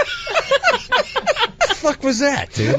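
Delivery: high, slow, laughing mock rasp. [name] asking the host what happened, slowly. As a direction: stretch it out in disbelief. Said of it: "he's just like".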